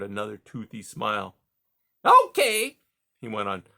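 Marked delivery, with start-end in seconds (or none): 2.0-2.8 much higher, louder hearty voice; hearty beaver. A narrator's reading. Beaver Mister Conrad greeting caged Rye, high and hearty. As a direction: boom out a jovial salesman beaver.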